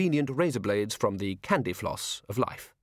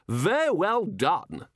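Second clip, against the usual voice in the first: patronising posh guest; 8 semitones higher, much slower than usual. Acted.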